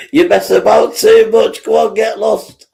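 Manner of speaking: high, loud mock dismissal; loud punchline repeat. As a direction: belt the punchline out gleefully. Repeats punchline loudly, cracking up.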